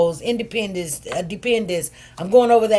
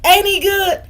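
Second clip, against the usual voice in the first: loud, high praise of God; joyful church exclamation; 9 semitones higher, 6 dB louder than usual. Real, not acted. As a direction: lift it up in praise.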